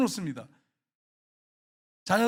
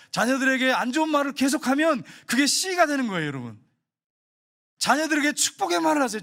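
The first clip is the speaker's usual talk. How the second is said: raised, pleading sermon voice, higher and louder; fervent preaching; 6 semitones higher, 4 dB louder than usual. Real, not acted. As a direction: press the congregation hard, voice climbing. Impassioned plea to parents. (목소리를 높여 간절히 호소하는 설교)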